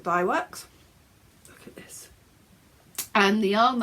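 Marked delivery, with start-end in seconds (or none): 1.5-2.1 soft unvoiced whisper. Real, not acted. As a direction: breathe it, no voice. Showing something close to camera.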